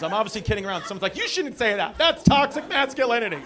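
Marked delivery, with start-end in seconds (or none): 1.2-3.4 higher, scolding complainer voice; put-on offended protest; mock outrage. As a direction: imitate an indignant objector. Mimics an offended audience member.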